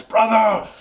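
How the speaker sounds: loud, high orc battle cry; orc yelling. Acted. Orc at the back to his bloodied comrade, yelling. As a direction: call out hard across the battlefield. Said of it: "yells out in Orcish".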